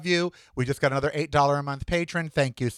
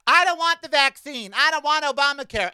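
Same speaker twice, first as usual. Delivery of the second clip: mocking anti-vaxxers; 9 semitones higher than usual. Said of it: "these anti-vaxxers".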